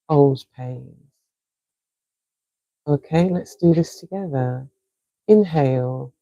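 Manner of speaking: calm, unhurried breathing coach; soothing breathwork guide with long pauses; guided breathing. Acted. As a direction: count them through each breath gently. Leads a guided breathing exercise.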